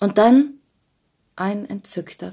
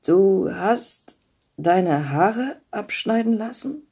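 a slow, halting Jim, lightly voiced; labored question; 3 semitones higher, slower than usual. A narrator's reading. Jim stunned, asking Della with effort about her hair. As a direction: struggle to get the words out. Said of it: "fragte Jim mühsam".

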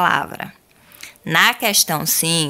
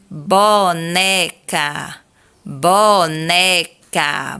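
very slow "boneca" broken into beats; syllable by syllable; much slower than usual. Shown as a demonstration. Teacher slowly clapping out "boneca" for pupils. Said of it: "falar pausadamente, batendo palmas".

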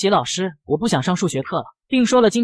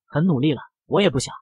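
ordinary delivery

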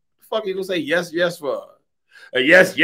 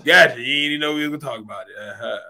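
ordinary delivery

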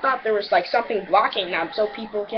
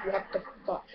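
soft low mutter; quietly stunned; muttered disbelief; 3 semitones lower, 11 dB quieter than usual. Retold or imitated. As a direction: mutter it under your breath, baffled. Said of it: "And I'm like".

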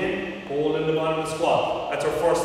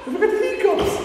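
asked through laughter; laughing; faster than usual. Real, not acted. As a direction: giggle through the question. Laughing at the hiccups.

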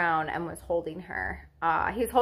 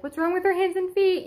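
much higher, worried retold question; retold alarm; 11 semitones higher, 4 dB louder than usual. Mother seeing newborn's photos, alarmed. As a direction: voice shoots up in mock alarm.

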